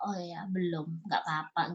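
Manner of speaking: soft, low murmur to oneself; retold acceptance, said low and very softly; resigned self-reassurance. Daughter consoling her own heart, quietly resigned. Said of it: "bikin legowo hatiku".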